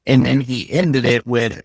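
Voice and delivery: ordinary talk, nothing marked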